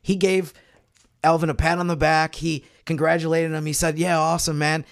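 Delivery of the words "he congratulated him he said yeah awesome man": ordinary talk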